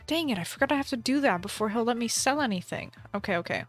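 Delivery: normal talking voice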